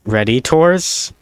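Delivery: Redditors drawn out slowly as "red-ih-TORS", rising; slow, rising red-ih-TORS with the last syllable stressed; fake French. Shown as a demonstration. Coining an inside joke for viewers, slow fake French Redditors. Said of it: "I pronounce Redditors Redditors".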